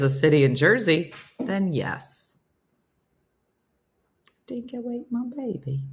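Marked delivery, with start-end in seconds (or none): 4.5-5.8 soft, higher Aussie movie quote; a hint of Australian accent; mock Australian quote. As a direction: sneak the forbidden quote in quietly. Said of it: "You're not supposed to say that anymore".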